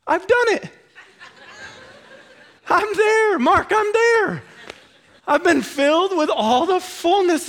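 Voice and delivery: mock-proud, high and laughing, claiming he has arrived; smug self-satisfied believer. Preacher mocking a smug churchgoer who thinks he's arrived, laughing and high.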